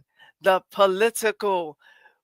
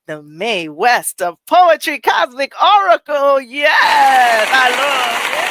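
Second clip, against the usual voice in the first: hype shout; 5 semitones higher, 10 dB louder, slower than usual. Pretend. Hyped introduction of poet.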